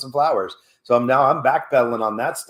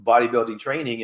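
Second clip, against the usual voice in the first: ordinary delivery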